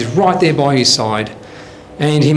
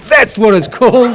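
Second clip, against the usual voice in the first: loud, higher-pitched burst of recognition; excited recognition; 7 semitones higher, 5 dB louder than usual. Acted. Bookshop customer who hears the title he forgot, excited recognition. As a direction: pounce on it, loud and high.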